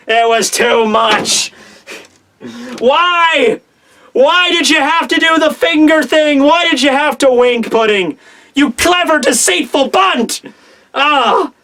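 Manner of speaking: hammed-up high cry of despair; anguished wail. Fan undone by Pudding's finger thing, mock-anguished wail. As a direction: lament it theatrically, stretching the words.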